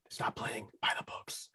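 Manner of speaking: breathy whispered tagline. Acted. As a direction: whisper it like a secret motto.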